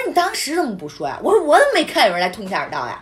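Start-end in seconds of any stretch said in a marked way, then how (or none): 0.0-1.2 angry demand to the landlady; retold angry demand. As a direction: throw the question back at her.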